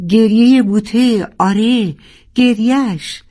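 high loud call of discovery; shout. Acted. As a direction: cry it out across the sea. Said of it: "و داد زد". (فریاد بلند)

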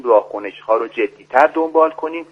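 ordinary talk, nothing marked

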